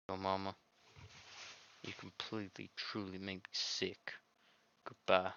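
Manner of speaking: put-on quiet, wounded girl; softly read heartbroken heroine. The deleted heroine mourning to the player, soft and hurt. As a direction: let her plead quietly, hurt. Reads heroine's final lines.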